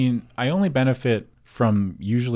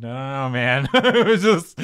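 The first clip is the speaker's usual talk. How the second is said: laughing; 11 semitones higher than usual. Real, not acted. Laughing at own slip.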